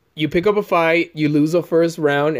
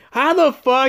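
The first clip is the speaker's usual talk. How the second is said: retold screech; 9 semitones higher, slower than usual. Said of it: "She was like".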